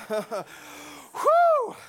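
high, loud whoop of relief; exhaled high-pitched whew; relieved whew. Real, not acted. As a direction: let out a big whew after a hard song. Relief after straining high notes.

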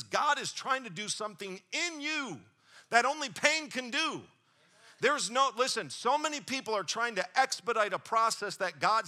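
full-force sermon delivery; preaching. Acted. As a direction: drive it home to the congregation. Preaching climbs again.

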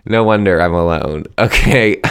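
ordinary talk

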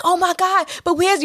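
high-pitched, indignant alarm; mad friend. Retold or imitated. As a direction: imitate a friend outraged on your behalf. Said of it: "you know, she's mad".

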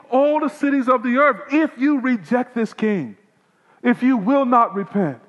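high, loud thundering pronouncement of woe; booming pulpit woe; preaching at full force. Acted. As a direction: cry out each woe like a prophet.

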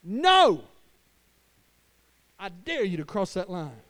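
slow, daring challenge at the line; defiant stand. Pretend. Father standing his ground against the enemy, slow and defiant. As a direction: dare them to cross it. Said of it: "you gotta stand up and go".

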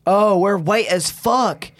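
loud, slow shocked exclaim; surprised. Real, not acted. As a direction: drag it out in surprise. Screen turns bright.

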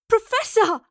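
high, loud, desperate call; crying out. A narrator's reading. Captive Astrid to the professor, crying out. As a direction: cry out to her for help. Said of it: "Astrid cried".

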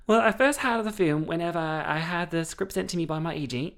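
imitating a polite Australian actress; a lightly put-on Australian accent. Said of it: "I do apologize for my Australian accent earlier".

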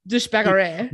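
douchebaggery with a mock French accent. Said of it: "as the French would say".

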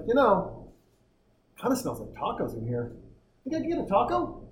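put-on high, sing-song little kid voice; four-year-old daughter. Four-year-old daughter to dad in the men's room, a high little-kid voice. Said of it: "She's like".

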